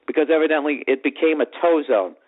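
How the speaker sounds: ordinary delivery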